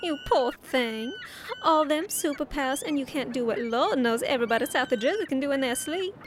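condescending Southern belle; Southern accent. Acted. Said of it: "Why can you do a Southern accent?".

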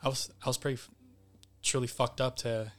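ordinary delivery

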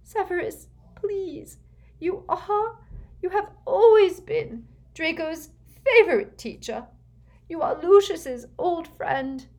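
high, desperate begging; nervous pleading. A narrator's reading. Desperate mother begging Snape to persuade the Dark Lord, pleading. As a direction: implore him, voice pitched well up. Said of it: "seemed to lose her nerve".

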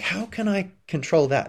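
high-pitched mock frustration; frustrated. Imitating a frustrated person fighting the inevitable, mock frustration. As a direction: whine at what can't be controlled. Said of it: "getting frustrated 'cause you're like".

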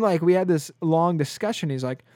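ordinary talk

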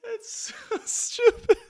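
real high-pitched laughing squeal; helpless laughter. Real, not acted. Host cracks up at own joke, laughing helplessly. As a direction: squeeze the words out through laughter.

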